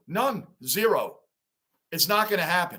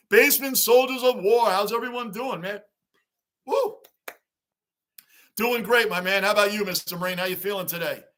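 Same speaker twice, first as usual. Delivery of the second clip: ordinary talk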